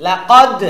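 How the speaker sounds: "লাকাদ" loud, with a hard bounced d; strong qalqalah push. Shown as a demonstration. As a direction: punch the end of "লাকাদ" loudly into an echo. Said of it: "একটু ধাক্কা জোরে দিতে হবে".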